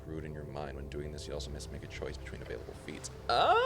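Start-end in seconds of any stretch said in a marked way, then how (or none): 3.3-3.7 sharp high startled cry; sudden squeal of surprise; surprised yelp. Real, not acted. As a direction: jump at something on screen. Sudden reaction while playing.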